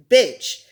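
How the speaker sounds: bitch with a short i; correct pronunciation. Shown as a demonstration. Teaching the right pronunciation, bitch with short i. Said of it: "the right pronunciation is".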